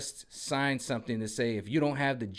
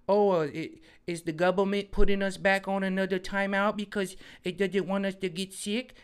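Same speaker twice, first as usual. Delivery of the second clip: higher, innocent questioning voice; code word demo; 6 semitones higher than usual. Shown as a demonstration. Teaching viewers to speak in code, innocent higher voice. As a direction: ask it sweet and innocent. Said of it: "just go".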